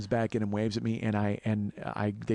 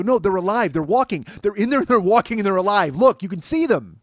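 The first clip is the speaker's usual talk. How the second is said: loud, high panicked insistence; yelling that the bugs are alive; 7 semitones higher, 8 dB louder than usual. Retold or imitated. Telling Jen in a loud high panic.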